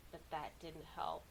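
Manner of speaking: ordinary delivery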